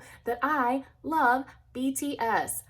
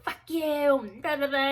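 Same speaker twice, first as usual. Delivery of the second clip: higher-pitched mock angry retort; retold pissed-off outburst; 6 semitones higher than usual. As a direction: replay a mad comeback, pitched up. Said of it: "I was pissed".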